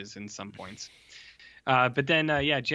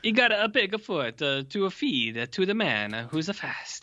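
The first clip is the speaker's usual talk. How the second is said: hammed-up Italian accent with added vowels; mock Italian accent; 4 semitones higher than usual. Podcaster jokes about monk bringing the foot, mock Italian accent. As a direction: put on a singsong stage Italian.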